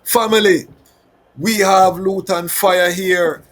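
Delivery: high, loud hype announcer shout; excited hype. Real, not acted. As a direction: pump up the crowd. Hyping the audience, announcing.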